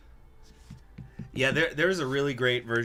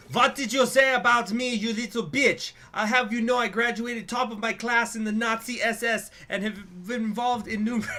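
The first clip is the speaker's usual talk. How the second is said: put-on high, loud, enraged German accent; mock German accent; 11 semitones higher, 6 dB louder than usual. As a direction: rant furiously in a hammed-up German accent. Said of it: "I'm not gonna do the accent".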